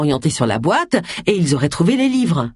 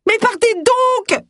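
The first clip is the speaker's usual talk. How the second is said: put-on much higher, slow, furious old woman's yell; shouting; slower than usual. A narrator's reading. Harriet ordering Agatha and Phil out, shouting. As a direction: Harriet shrieks them out, drawn out high. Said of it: "hurla Harriet".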